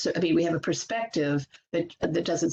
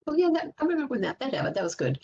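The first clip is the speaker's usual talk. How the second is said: quick, higher bright recalling; light, rushed delight at a memory; a quick burst of happy remembering; pleased recall; 8 semitones higher, faster than usual. Retold or imitated. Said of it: "imagined recalling a happy moment".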